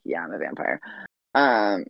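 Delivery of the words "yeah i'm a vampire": brooding vampire confession. Retold or imitated. Edward confessing in retold movie.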